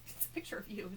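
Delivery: soft unvoiced whisper; whispered aside. Fan quietly pointing out the photo is of him, whispered. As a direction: confide it under your breath.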